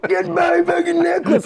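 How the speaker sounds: high, slow imitated bum shout; mock shout. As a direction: bark it like the pushy bum.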